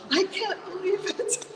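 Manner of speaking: high, laughing, rising at the end; laughing disbelief. Retold or imitated. Repeating the news to herself, laughing in delight. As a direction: giggle the words out in delight. Excited alone at home after the offer.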